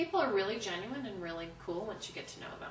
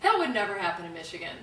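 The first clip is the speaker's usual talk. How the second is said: ordinary delivery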